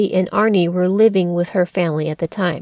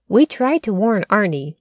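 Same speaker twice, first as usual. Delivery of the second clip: ordinary delivery, nothing marked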